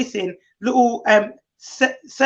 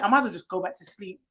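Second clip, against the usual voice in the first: ordinary talk, nothing marked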